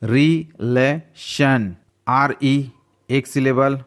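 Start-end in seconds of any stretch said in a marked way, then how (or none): 0.0-1.8 relation very slowly, as रि, ले, शन with pauses; split into three syllables. Shown as a demonstration. Teacher showing three-syllable words, relation slowly split in three. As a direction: give relation three separate, drawn-out beats. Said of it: "three syllable वाले word देख लेते हैं".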